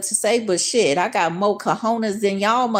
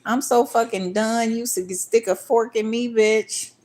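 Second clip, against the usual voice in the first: imitating him, pitched higher, exasperated; mock voice of him; 4 semitones higher than usual. Imitating the man complaining about her, pitched higher. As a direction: act out his whiny complaint, higher. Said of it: "He like".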